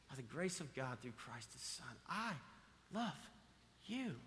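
tender, hushed voice of God. Retold or imitated. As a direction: whisper God's love gently.

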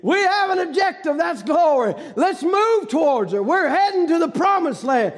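fervent revival shout; preaching at full force. Acted. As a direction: lift them up toward the goal. Preacher rallying congregation.

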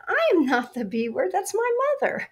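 high, offended protest; mock outrage, rising; indignant denial. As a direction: bristle at the accusation. Retold indignant denial, then joke.

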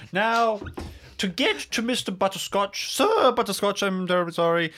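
put-on high, theatrical old eccentric; eccentric old Winston. Explaining how to reach Sir Butterscotch, high eccentric voice.

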